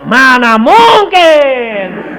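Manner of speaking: slow, drawn-out doubtful scoff; mock incredulous drawl; disbelief. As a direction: stretch it out like you can't believe it. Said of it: "Karena pikirnya".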